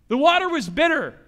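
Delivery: normal talking voice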